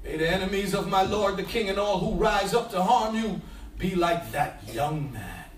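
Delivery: low, measured Bible reading; solemn reading of the story of Absalom; scripture reading. A narrator's reading. Reads scripture passage aloud.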